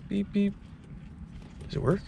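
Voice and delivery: ordinary talk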